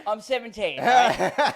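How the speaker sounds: laughing through the words; high, giggling disbelief. Real, not acted. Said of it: "laughs while saying".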